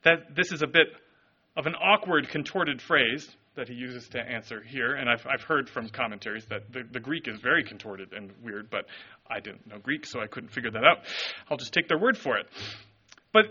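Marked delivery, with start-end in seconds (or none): none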